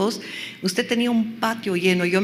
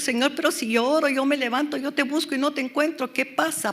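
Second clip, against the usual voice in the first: higher-pitched questioning complaint to God; acted believer's plea; 5 semitones higher than usual. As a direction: plead upward as a puzzled believer. Voices a dry believer pleading with God.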